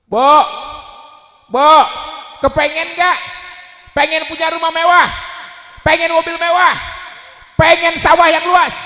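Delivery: loud, high shouted questions; rousing the crowd. Real, not acted. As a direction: fire up the crowd like a rally.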